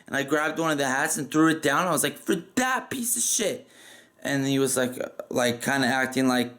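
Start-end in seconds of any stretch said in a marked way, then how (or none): 2.2-3.7 hammed-up scornful question; overdramatic disbelief. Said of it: "over dramatically".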